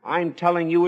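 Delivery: ordinary delivery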